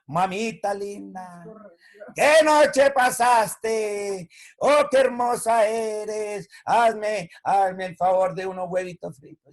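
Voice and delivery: sing-song buttering up; syrupy sweet-talk again. Retold or imitated. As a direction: gush over mamita to get breakfast. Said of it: "Y el otro día".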